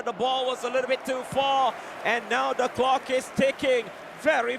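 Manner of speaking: ordinary talk, nothing marked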